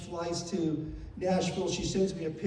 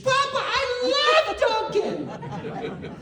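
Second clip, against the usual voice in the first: put-on very high, loud, drawn-out child squeal; imitated excited little girl; 7 dB louder, much slower than usual. Imitating his young granddaughter answering grandpa, excited squeal. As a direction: squeal it like a delighted small child.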